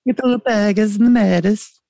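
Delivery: high, exaggerated Scouse accent. Shown as a demonstration. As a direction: show off a comic Scouse accent. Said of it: "I would do my Scouse accent".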